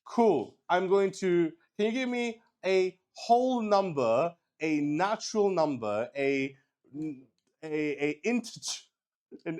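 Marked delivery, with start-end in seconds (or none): none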